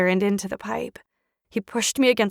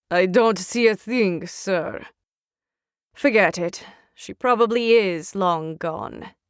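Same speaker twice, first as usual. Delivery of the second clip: soldier voice; 3 semitones lower than usual. Acted. Soldiers reporting from pipe.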